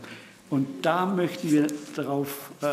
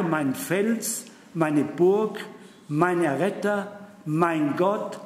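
solemn scripture reading; 3 dB louder, slower than usual. Acted. Preacher reading Psalm 18 to congregation, solemn. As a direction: read the psalm slowly with weight. Reads Psalm aloud in sermon.

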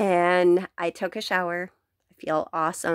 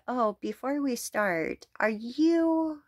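prim, high questioning tone, voice rising sharply at the end; snooty customer; 6 semitones higher, 5 dB quieter than usual. Retold or imitated. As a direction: mimic a nosy customer sizing her up. Customer questions her gender.

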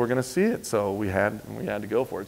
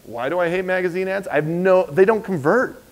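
ordinary talk

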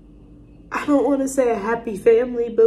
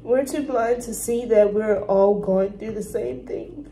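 ordinary talk

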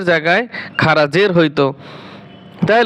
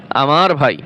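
usual speaking voice

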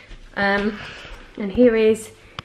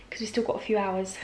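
whispering; 2 semitones lower, 10 dB quieter than usual. Real, not acted. Sudden whisper aside.

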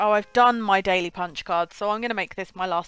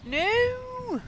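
a long high wail of no; high drawn no; 13 semitones higher than usual. Real, not acted. Streamer losing the fight, long high wail.

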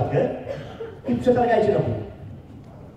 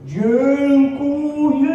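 long, drawn-out whale call, higher pitched; whale-speak; 5 semitones higher, much slower than usual. Shown as a demonstration. Speaker imitates the forgetful fish thanking a whale, in slow drawn-out whale-speak. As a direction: stretch every syllable slowly like a singing whale. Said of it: "Po waleńsku. Mówiła tak".

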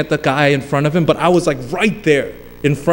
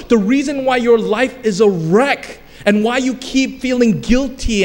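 raised-pitch insistent sermon delivery; impassioned preaching; 7 semitones higher than usual. Real, not acted. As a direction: drive each repeated point home to the congregation. Preacher builds to forceful exhortation.

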